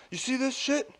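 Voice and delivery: high-pitched imitation of a complaining coworker; mimicked stranger. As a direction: put on the griping worker's high voice. Retold odd stranger complaining.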